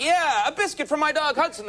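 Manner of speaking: put-on thrilled like a kid; host thrilled about the biscuit offer, gushing; giddy excitement. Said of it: "And I'm like".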